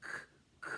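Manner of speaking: breathy "k-" repeated; sounding out. Shown as a demonstration. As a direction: whisper the "k-" sound. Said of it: "our letter of the week".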